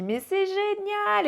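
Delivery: high, slow, stretched-out delight; thrilled. Retold or imitated. Midwife delighted as the baby arrives, high and slow. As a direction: savour the wonder.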